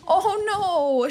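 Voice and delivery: ordinary talk, nothing marked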